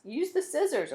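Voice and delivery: lightly voiced woman calling back; woman's voice. A narrator's reading. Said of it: "a woman's voice answered".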